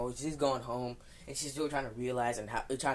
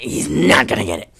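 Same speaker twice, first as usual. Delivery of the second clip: sudden shout; 2 semitones lower, 18 dB louder than usual. Real, not acted. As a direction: blurt it out much louder. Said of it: "Not sure if you heard that".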